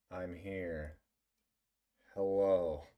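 ordinary delivery

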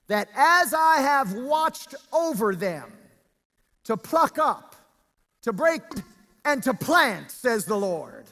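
high, forceful scripture preaching, drawn-out key words. Real, not acted. Preacher reads God's promise to congregation, preaching. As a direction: preach the verse out with full weight.

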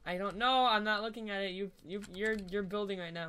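normal talking voice

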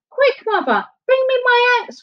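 very high, panicked shout; urgent cry. A narrator's reading. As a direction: yell for the axe in panic. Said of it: "cried Jack".

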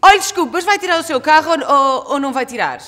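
loud, high demand to a driver; cheeky confrontation. Retold or imitated. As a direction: re-enact brazenly challenging a stranger through the car window. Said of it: "digo assim com uma lata". (com muita lata, alto e agudo)

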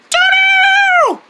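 very high, long squealed note; mouthed guitar tune. Pretend. Player starting up the guitar, shrill high note.

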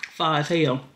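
ordinary delivery

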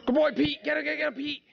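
high, excited urging; egging on the dog. Real, not acted. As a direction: cheer the hound on in a high voice.

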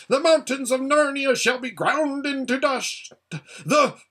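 high, loud ranting curse; furious curse. Acted. Rabadash cursing Aslan, high and ranting. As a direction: curse him with everything you have.

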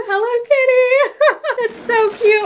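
high, drawn-out squeal with a laugh; real excitement. Real, not acted. Delighted by a Hello Kitty shirt, excited squeal. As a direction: stretch the name high, then laugh. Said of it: "I'm so excited to open this".

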